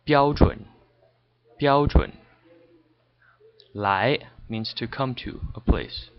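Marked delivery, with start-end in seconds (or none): 0.1-2.1 标准 said twice with careful tones; 标准 stressed, first tone then third tone; Chinese tones. Shown as a demonstration. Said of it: "and it's standard".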